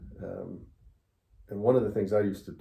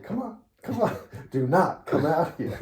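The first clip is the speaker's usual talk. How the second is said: tough taunt; 8 semitones higher, 4 dB louder than usual. Retold or imitated. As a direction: wave him on, daring. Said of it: "I'm like".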